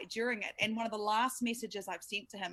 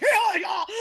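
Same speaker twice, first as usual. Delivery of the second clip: high, loud shout of joy; excited shout; 11 semitones higher, 12 dB louder than usual. Retold or imitated. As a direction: yell it as the ball goes for six. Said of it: "I was like".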